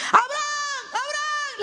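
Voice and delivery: very high, drawn-out call; imitated shout from heaven. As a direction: cry out the name as if from far away, held back in volume. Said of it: "le gritaron".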